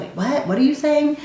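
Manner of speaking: high, puzzled wait what; straining to understand a mumbling husband; confused asking. Retold or imitated. Wife asking her mumbling husband to repeat, confused and high. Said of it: "you're always like".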